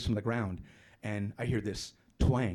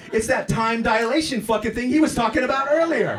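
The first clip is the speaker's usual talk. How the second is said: high, loud outburst of realization; 12 semitones higher, 10 dB louder than usual. Retold or imitated. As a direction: blurt it out at full volume. Sudden realization in the story.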